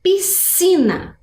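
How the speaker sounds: "Piscina" slow, with one long s sound; demonstrated pronunciation. Shown as a demonstration. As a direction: model "Piscina" slowly and high. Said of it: "Vou fazer a pronúncia de novo". ("Piscina" devagar, com um som único de s)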